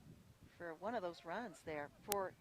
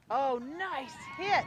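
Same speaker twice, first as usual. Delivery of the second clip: higher, much louder, drawn-out cheer; excited call; 6 semitones higher, 11 dB louder, slower than usual. Real, not acted. Announcer calling a gap hit, excited cheer. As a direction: sell the big hit to listeners. Batter drives the ball.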